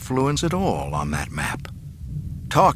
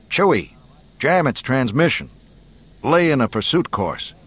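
higher, louder commands; urgent orders; 5 semitones higher, 4 dB louder than usual. A narrator's reading. Solo to Chewie in pursuit, urgent orders. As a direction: give punchy orders mid-chase. Pilot orders his crewmate in a chase.

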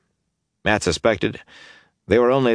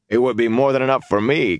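dry; 7 semitones higher than usual. A narrator's reading. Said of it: "Carloman said dryly".

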